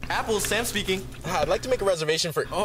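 ordinary talk

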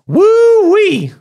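high, loud Southern woo-wee; Southern holler. Pretend. Cueing the Southern accent guy, loud Southern holler. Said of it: "Cue the Southern accent guy".

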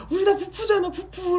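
very high, loud, tearful squeal; shrieking adoring fan near tears. Retold or imitated. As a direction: gush over him like an overwhelmed fan. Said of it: "이러면서".

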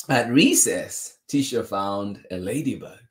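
plain reading voice

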